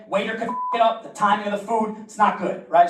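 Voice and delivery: normal talking voice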